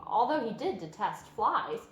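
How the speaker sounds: plain reading voice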